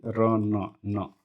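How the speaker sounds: र and न said slowly as separate sounds, न repeated; separated sounds. Shown as a demonstration. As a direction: break रन into र, न, then न alone. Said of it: "न पर stress आ रहा है".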